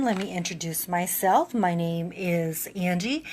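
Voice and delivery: usual speaking voice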